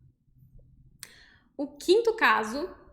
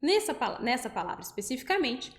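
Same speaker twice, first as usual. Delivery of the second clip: normal talking voice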